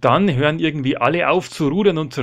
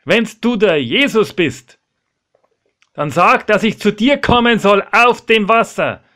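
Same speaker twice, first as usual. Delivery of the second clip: loud, high dare; challenging call; 7 semitones higher, 7 dB louder than usual. Acted. Petrus challenges Jesus to call him onto the water, loud shout. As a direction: shout a challenge across the waves.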